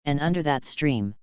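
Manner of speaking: ordinary delivery, nothing marked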